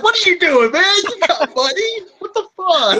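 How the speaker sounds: high-pitched, loud mock disbelief; incredulous outburst. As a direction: call him out, voice jumping way up. Retold outburst at the thief.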